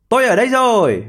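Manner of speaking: high storybook call. A narrator's reading. Hedgehog announcing himself to the tired hare, calling out. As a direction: sing out the announcement, pitched high.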